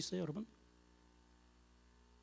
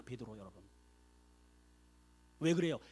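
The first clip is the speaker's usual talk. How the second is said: usual speaking voice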